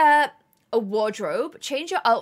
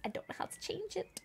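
hushed, helpless whisper; very soft unvoiced confession; whispered aside; 6 semitones higher, 16 dB quieter than usual. Real, not acted. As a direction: whisper it under your breath.

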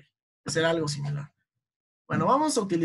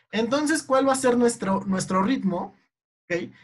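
ordinary delivery

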